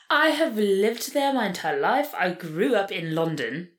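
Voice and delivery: lightly British, like Call the Midwife; British accent. Pretend. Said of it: "You sound like someone off of Call the Midwife".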